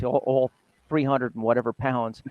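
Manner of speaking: ordinary delivery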